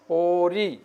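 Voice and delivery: পড়ি stretched, its final ই held; drawn-out ending. Shown as a demonstration. Teacher showing students how to pronounce it, পড়ি drawn out. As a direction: hold the last vowel of পড়ি long. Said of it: "তোমরা এভাবে টানে উচ্চারণ".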